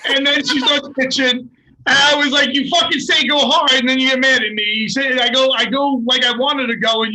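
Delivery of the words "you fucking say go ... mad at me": indignant retort. Retold or imitated. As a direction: retell an exasperated argument, raised voice. Retelling his argument with her.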